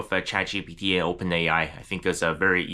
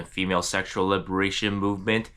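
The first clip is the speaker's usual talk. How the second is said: normal talking voice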